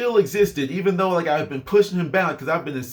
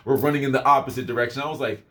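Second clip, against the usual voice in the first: ordinary delivery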